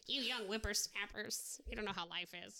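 lightly voiced cranky old-timer; grumpy old man voice. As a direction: scold the kids like a grouchy elder. Playing a grumpy old person.

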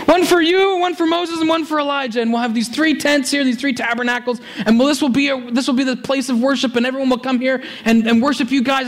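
high, fast, flustered babbling; a frantic disciple blurting out ideas; panicky Peter. Retold or imitated. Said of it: "he's freaking out".

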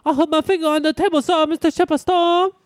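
put-on high, fast little kid; whiny kid voice. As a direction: play a kid tattling to teacher. Kid talking to shop teacher.

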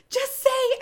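high loud call-out; shouted line. Retold or imitated. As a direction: yell it at the screen. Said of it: "I shouted out".

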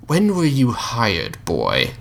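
slow, suspicious, drawn-out question; the Mouth's slow interrogation. Acted. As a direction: pin the boy down slowly.

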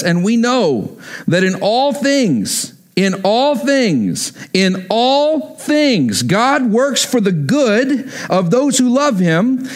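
preacher proclaiming a verse; emphatic scripture reading. Acted. Reads scripture to congregation.

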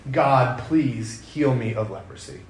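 slow, soft pleading prayer; weary prayer. Retold or imitated. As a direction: beg God quietly, worn down. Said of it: "how many times he has prayed".

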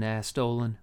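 usual speaking voice

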